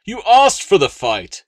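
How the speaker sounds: high, loud spluttering protest; spluttered. Acted. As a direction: sputter it out in outrage. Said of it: "he spluttered again".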